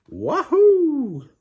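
very high, loud held wahoo; joyful whoop. A narrator's reading. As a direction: whoop with pure delight. Duck's joyful thought.

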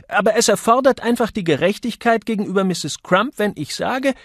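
high, loud, scolding woman; indignant Misses Johnson. A narrator's reading. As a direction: tell him off from on high. Woman insisting on fairness.

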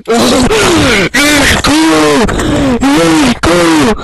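high, loud, triumphant scream; shrieking jackpot winner. Real, not acted. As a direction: scream it like you just hit the jackpot. Celebrating beating the record.

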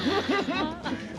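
high, helpless giggling; ticklish laughter. Acted. Being tickled during playful embrace.

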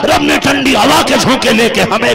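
loud, high impassioned sermon chant; full-force preaching. Acted. (पूरे जोश में ऊँचा उपदेशी स्वर)

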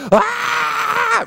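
sudden high piercing mock wail. Imitating the widow falling to the floor, high wail. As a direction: cry out like the grieving widow.

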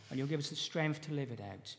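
ordinary talk

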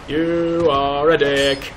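ordinary delivery

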